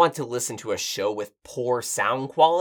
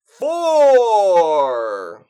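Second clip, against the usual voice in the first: high, loud, long-held count; showy sound check; 7 semitones higher, 8 dB louder than usual. Acted. Man stubbornly finishing his count, showy. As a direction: draw the number right out, sliding down. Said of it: "capture the full range of the complexity of my voice".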